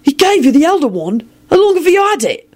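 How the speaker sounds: high-pitched, incredulous questions; amazed nephew demanding details; astonished. Acted. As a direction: pitch leaping up in disbelief. William stunned by the wand news.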